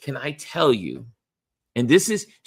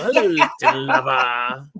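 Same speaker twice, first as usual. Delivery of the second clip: flirty hello, laughing through it; mock seductive, cracking up; laughing flirt; 5 dB louder than usual.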